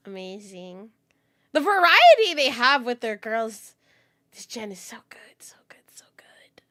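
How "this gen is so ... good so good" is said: fading whispered praise; gushing whisper. Real, not acted. Streamer gushing over the new generation, whispered. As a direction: whisper it, dying away.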